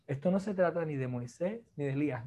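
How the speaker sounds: ordinary talk, nothing marked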